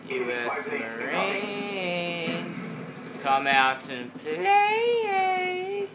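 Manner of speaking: slow sing-song taunt cracking into a high squeal; voice breaking. Pretend. Gamer calling out the Marines, voice breaking high on play. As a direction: drag it out until the voice breaks sky-high. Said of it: "how my voice just broke".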